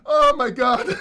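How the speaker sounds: helpless crying laughter; laughing to tears. Real, not acted. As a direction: laugh so hard you nearly cry.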